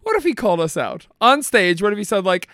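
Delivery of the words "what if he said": ordinary talk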